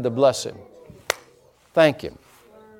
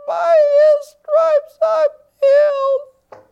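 tiny scratchy squeak, very high and slow; weak, pathetic voice; 5 dB louder, much slower than usual. Retold or imitated. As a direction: imitate a small, sick little voice. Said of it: "weak, pathetic voice".

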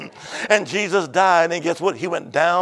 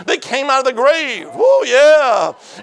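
exultant preaching; 7 semitones higher, 8 dB louder, slower than usual. Real, not acted. Preacher telling of saints leaving the grave, exultant shout. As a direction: rise into a high, loud cry.

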